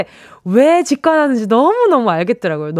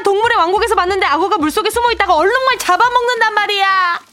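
scared whining; 9 semitones higher than usual. Acted. Scripted skit, scared protest.

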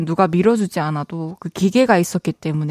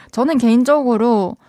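ordinary talk

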